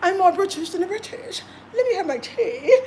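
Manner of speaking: lightly mocking British airs; mock posh Brit. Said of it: "A lot of them wanna be British".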